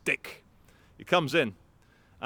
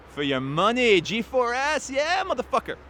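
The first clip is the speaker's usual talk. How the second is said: mocking taunt; 9 semitones higher than usual. Real, not acted. Ranting at the company.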